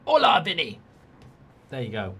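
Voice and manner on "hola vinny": high, loud mock Spanish accent. Streamer greets Vinny in a mock Spanish accent. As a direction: call out in a put-on Spanish accent. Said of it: "do my shout-out in a Spanish accent".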